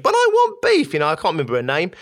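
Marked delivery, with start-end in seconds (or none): 0.0-0.9 put-on high, loud childish whine of protest; petulant child. Inner child refused beef, loudly petulant. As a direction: insist like a thwarted child. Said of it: "this inner petulant child that says".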